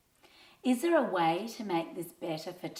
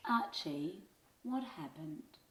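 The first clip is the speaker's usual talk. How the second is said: gentle teacher; 10 dB quieter than usual. A narrator's reading. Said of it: "she asked".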